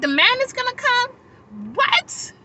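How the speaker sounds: high, loud disbelieving questions; replayed shock, pitch shooting up; incredulous. Speaker learning the man is coming too, incredulous.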